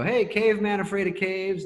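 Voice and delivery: high-pitched mock sketch pitch; pitching fake idea. Writer pitching a made-up sketch idea, mock-eager.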